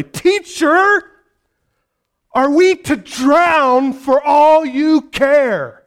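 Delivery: loud, high, drawn-out accusing shout; angry rebuke. Acted. Disciples rebuking the lazy teacher in the storm, angry shout. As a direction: yell over a storm, outraged. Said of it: "they angrily wake him".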